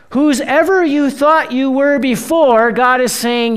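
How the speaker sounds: ordinary delivery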